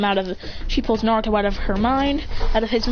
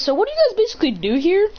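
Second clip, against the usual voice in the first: higher-pitched, swooping question; 9 semitones higher than usual. Acted. Character asking the others what they do, questioning.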